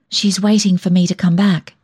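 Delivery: usual speaking voice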